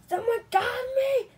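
high, drawn-out play scream. Pretend. Penguin toy grabbed by someone, high slow scream. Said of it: "Stop screaming".

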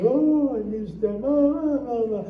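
hammed-up funeral bawling; mock crying. Joke teller plays everyone at the workers' funeral, mock crying. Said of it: "Everybody crying".

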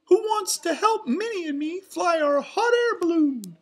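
high, loud cartoon mouse cheer; Mickey. A narrator's reading. Mouse rallying friends for a balloon ride, excited cheer. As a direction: cheer it in a squeaky falsetto. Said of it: "Mickey cheered".

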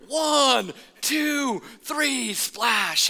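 high called-out count with a flat splash. Retold or imitated. Counting from the slide top, called out high.

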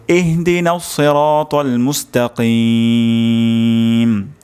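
slow, drawn-out melodic recitation; Quran recitation. Shown as a demonstration. Teacher recites the full verse melodically. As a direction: chant the verse with long held vowels. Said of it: "আমি আরবি তেলাওয়াত করছি".

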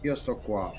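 usual speaking voice

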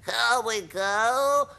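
slow, high, loud whoop; drawn-out high creature cry; grasshopper's excited shout. A narrator's reading. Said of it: "shouted the old green grasshopper".

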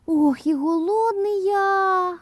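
very high, drawn-out mouse whine; hungry mouse. A narrator's reading. Mouse Krut arriving at the pies, high hungry moan. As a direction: stretch it out in a tiny high voice. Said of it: "каже Круть".

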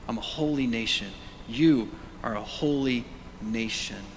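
ordinary talk, nothing marked

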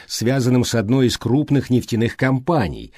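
ordinary delivery

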